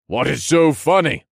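high, loud, irritated demand; exasperated outburst; annoyed. A narrator's reading. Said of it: "annoyed at his craziness".

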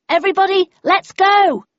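high, loud rallying cry; urgent shout to get everyone moving; shouted order. A narrator's reading. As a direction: round up the whole group at full voice. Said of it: "I shouted".

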